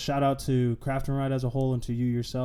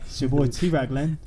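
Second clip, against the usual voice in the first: put-on English accent, higher and louder; playful British host sign-off; 4 semitones higher, 6 dB louder than usual. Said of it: "an English accent as we are signing off".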